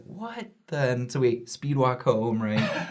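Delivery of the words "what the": stunned. Retold or imitated. Stunned exclamation, trailing.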